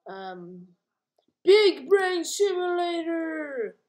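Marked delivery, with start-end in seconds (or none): 1.4-3.7 loud, slow hyped title call; excited announcing. Real, not acted. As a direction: announce it like a game show.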